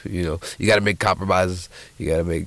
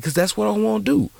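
put-on very high-pitched little boy voice; insistent young son. Boy telling his daddy his dream, high and insistent.

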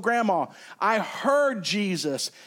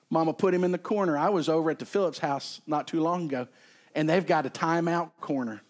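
ordinary talk

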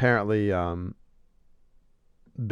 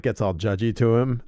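ordinary talk, nothing marked

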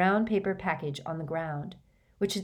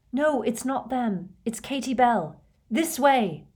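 raised, pressing voice; urgent; 6 semitones higher, 3 dB louder than usual. A narrator's reading. Harry leading Hagrid back to Katie, urgent. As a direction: call it out urgently.

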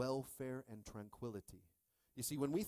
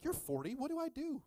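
high, louder pleading; mock desperate plea; 4 dB louder than usual. Pleading with his older buddy on the phone, high and desperate. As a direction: beg the older buddy for answers, voice jumping high. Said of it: "I'm like".

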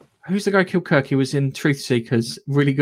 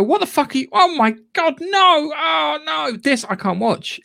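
horrified outburst; 11 semitones higher, slower than usual. Retold or imitated. Viewer reacting to a terrible show, high mock horror. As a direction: recoil from the screen in disgust. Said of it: "and go".

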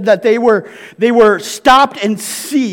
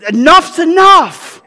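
higher, louder and slow, a put-on exasperated voice; fed up; 7 semitones higher, 4 dB louder, slower than usual. Husband halting a movie night, mock exasperated and loud. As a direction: draw it out like a fed-up husband stopping the film.

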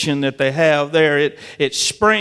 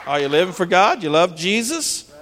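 ordinary delivery

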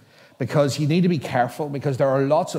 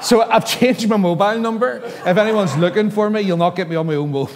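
laughing; 7 semitones higher, 7 dB louder than usual. Real, not acted. Laughing after the story's punchline.

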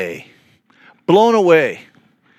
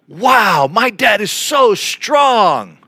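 drawn-out, higher, amazed "Wow, my dad is so strong"; awed wow; 6 semitones higher, much slower than usual. Pretend. Kids marveling at their dad, slow and amazed. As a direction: sound like a kid truly impressed by his dad. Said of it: "the intonation matters".

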